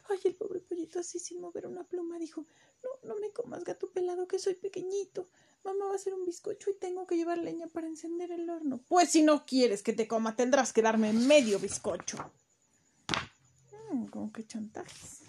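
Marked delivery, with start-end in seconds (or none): 2.8-8.8 frightened chick. Acted. Chick begs the cat for mercy. 8.9-12.2 loud, demanding cat voice; menacing cat. Acted. Cat blackmailing the chick, loud and menacing.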